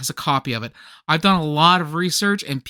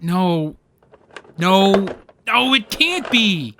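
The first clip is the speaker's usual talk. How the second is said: dramatic dismay; 2 semitones higher, 4 dB louder, much slower than usual. Acted. Cannot find his treasured tape.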